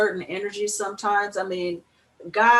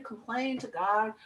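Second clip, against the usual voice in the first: normal talking voice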